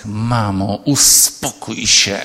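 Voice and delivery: a hint of a sulky teen hissing at mom; slow, drawn-out sulky hiss. Daughter hissing at her mother, lightly voiced bored teen. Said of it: "i ten syk".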